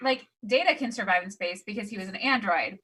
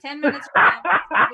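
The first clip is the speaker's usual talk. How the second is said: laughing tease; 7 semitones higher, 9 dB louder than usual. Real, not acted. Teasing the departing cohost.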